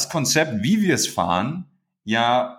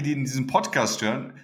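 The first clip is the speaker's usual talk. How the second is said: ordinary delivery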